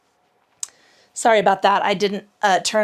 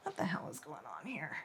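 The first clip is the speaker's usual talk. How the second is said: barely audible muttered question; muttering; 4 semitones lower, 21 dB quieter than usual. Real, not acted. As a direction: mutter it under breath. Phone acting up.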